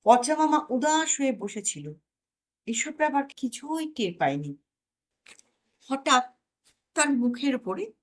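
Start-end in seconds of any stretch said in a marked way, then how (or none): none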